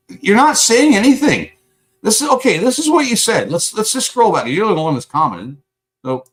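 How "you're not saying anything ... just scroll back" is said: high, louder angry ranting; furious outburst. Real, not acted. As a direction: yell at the troll in chat. Furious at chat user.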